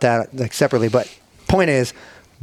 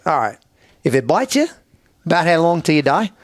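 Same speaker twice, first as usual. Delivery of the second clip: imitated Aussie with rising questions; Australian accent; 3 semitones higher than usual. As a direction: play the inquiring Australian bloke. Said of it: "here comes the Australian guy".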